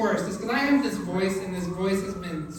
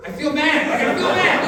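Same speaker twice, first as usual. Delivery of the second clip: loud, high whiny guilt with a laugh; mock guilt; 9 semitones higher, 9 dB louder than usual. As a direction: whine it out, laughing. Mock guilt over bugs.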